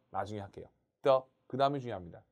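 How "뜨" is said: very short, light 뜨; just touch 뜨 and let go; light neutral tone. Shown as a demonstration. Teacher showing 경성, brief toneless 뜨. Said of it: "경성은 가볍게".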